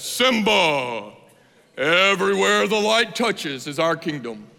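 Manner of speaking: slow, stately Mufasa proclamation; voice of God. Acted. Father lion showing his son the kingdom, godlike voice. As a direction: draw the words out like a mighty lion king. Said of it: "almost like the voice of God".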